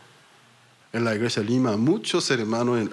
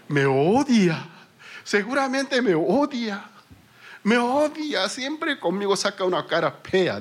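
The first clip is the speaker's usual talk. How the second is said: aggrieved complaint; 9 semitones higher than usual. Retold or imitated. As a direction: insist he hates you, pitch climbing. Offended member complains about pastor.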